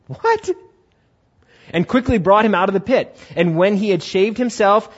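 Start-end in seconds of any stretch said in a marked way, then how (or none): 0.1-0.5 stunned. Retold or imitated. Joseph astonished, high.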